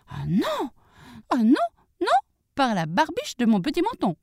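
high-pitched piglet refusal; defiant little pig. Acted. Little pig refuses to let the wolf in, high and defiant.

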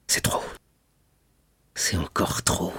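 hushed, nearly whispered dismay; murmured. A narrator's reading. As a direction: murmur it under your breath, low and soft. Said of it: "murmura Villefort". (murmuré, presque chuchoté)

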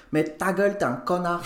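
imitated Chinese-accent comeback; Chinese-accent retort. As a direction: tell a friend off in a mock Chinese accent. Acts out his accented retort to friend.

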